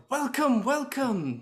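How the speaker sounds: high, loud, gushing welcome; effusive high-pitched host voice; dazzling host. Acted. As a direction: greet like a showy party host. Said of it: "being the dazzling host".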